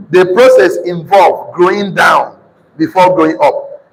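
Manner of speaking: slow, measured delivery for note-takers; slow dictation. Real, not acted. Preacher dictating to congregation writing notes, slow and emphatic. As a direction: spell out the point word by word, deliberately.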